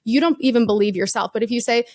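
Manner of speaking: usual speaking voice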